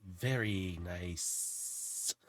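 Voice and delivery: slow, drawn-out creature voice, fading away; slow admiring drawl, trailing into a long hiss; Zar. Acted. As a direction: stretch it out and let it sink low and soft at the end. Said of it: "and you hear Zar".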